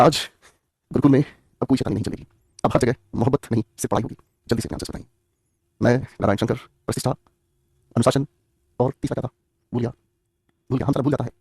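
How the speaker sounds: mock Mohabbatein principal, low and grave; film headmaster impression. Teacher mimics Narayan Shankar film dialogue. (Narayan Shankar की हल्की नकल, गहरी धीमी आवाज़)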